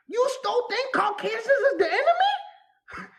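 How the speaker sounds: high laughing disbelief, slowed; shocked mocking laugh at spiritual folks; incredulous laughing. Real, not acted.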